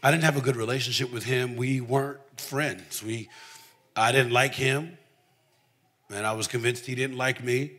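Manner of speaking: ordinary delivery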